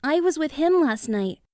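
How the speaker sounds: put-on a young bird begging for help; begging little ostrich. A narrator's reading. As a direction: plead like a small worried bird. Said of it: "the little ostrich begged".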